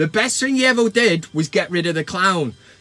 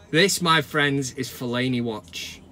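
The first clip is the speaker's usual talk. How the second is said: dramatic segment announcement; 6 semitones lower than usual. Acted.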